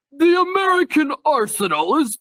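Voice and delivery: high, hammed-up British announcement; mock British outcry, laughing through it; British accent. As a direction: squeal it in a comic British accent. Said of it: "How does any British person".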